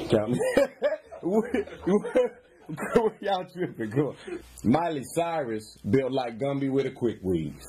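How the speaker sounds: ordinary talk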